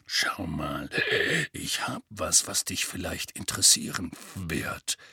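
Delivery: starts in a whisper, stays low and gruff; low hushed Moody. Acted. Moody confiding in Harry, low and hushed. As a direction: lean in and murmur it low.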